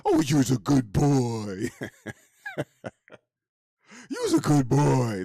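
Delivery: cooing to a dog. Retold or imitated. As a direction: coo at the dog like a proud owner. Baby talk to a dog.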